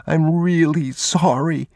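put-on high, slow child's plea; drawn-out boyish apology near tears; about to cry. A narrator's reading. Boy apologising to his father, high and near tears. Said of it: "he was about to cry".

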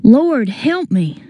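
slow, high-pitched appeal for help; desperate plea. A narrator's reading. Desperate plea in narrated peril.

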